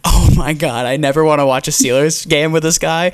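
ordinary delivery

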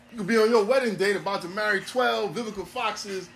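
ordinary delivery

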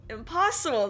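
second Impossible! flung up high and loud; Impossible! repeated, jumping up in pitch; dumbfounded cry. Acted. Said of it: "the king cried again".

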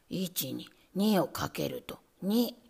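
low, slow, whispered lesson; lightly voiced nervous rat; rat teacher. A narrator's reading. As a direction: recite the sum carefully.

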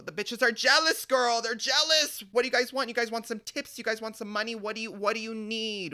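high, loud sassy taunting; hammed-up sassy clapback. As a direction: taunt the jealous girls. Mocking jealous coworkers, retold.

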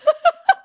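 sudden loud high laugh; burst of laughter. Real, not acted. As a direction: break out laughing. Reacting to the whisper.

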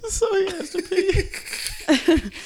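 high, slow and laughing; laughing so hard the words stretch out high. Real, not acted. Laughing recap.

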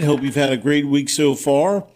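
normal talking voice

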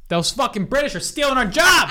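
high, indignant rant; mock outrage. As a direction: complain in hammed-up outrage. Mock outrage at British actors.

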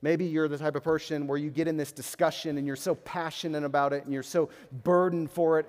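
usual speaking voice

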